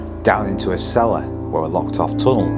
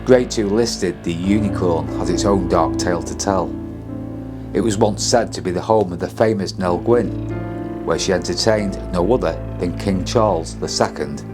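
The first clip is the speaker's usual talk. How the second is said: measured, ominous ghost-show voiceover; documentary narration; 12 semitones lower than usual. A narrator's reading. Spooky documentary voiceover over music.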